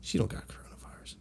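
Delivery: unvoiced whisper to himself; whispered thought. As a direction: hush it like a private thought.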